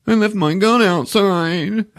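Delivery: high, slow mock-child voice; whiny kid. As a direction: plead like a sulky boy. Voices tearful Ralphie to his mom.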